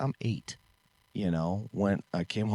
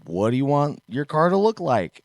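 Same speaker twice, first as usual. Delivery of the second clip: loud, high, cheery dad voice; eager dad; 7 semitones higher, 6 dB louder than usual. Retold or imitated. Dad asking his eight-year-old son about the car, loud and high. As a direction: play the keen dad, way up and loud.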